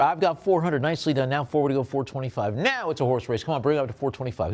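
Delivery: brisk, pushing auction call; auctioneer call. Acted. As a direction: drive the bidding up, keep it rolling. Auctioneer calling for bids.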